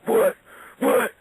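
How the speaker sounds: loud, high taunting What What; mocking shout. Wrestler taunting his pinned opponent, a loud mocking shout. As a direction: yell it back in mock disbelief.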